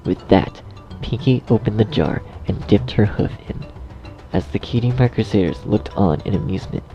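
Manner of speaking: ordinary delivery, nothing marked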